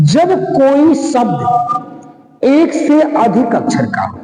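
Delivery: stretched out, each word weighed; slow emphatic rule. Shown as a demonstration. Teacher stressing the rule to students, slow and deliberate. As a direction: spell out the rule slowly for the class. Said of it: "teacher repeats rule slowly".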